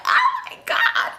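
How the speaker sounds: very high, slow squeal; mock swooning squeal; excited. Single woman flattered by men asking her to stay, squealed excitement. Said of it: "you just be so excited".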